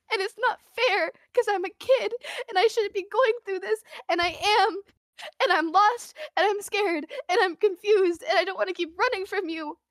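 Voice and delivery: put-on a lost kid begging the sheriff to believe her; sobbing plea. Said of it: "I'm, like, sobbing".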